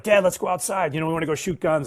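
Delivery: lightly voiced little boys begging; eager kids. Little sons begging dad to go shoot guns, eager kid voice.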